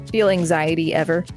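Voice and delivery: usual speaking voice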